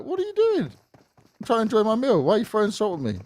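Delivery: high-pitched mock indignation; acting out an affronted diner; indignant diner. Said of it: "I'll be like".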